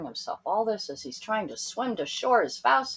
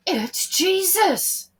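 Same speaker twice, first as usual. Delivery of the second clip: higher, slow, awed; amazed recognition; 11 semitones higher, 7 dB louder, slower than usual. A narrator's reading. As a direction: say it slowly in wonder. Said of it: "he said".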